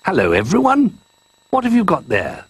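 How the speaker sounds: high friendly creature voice. Acted. Friendly creature greets toys.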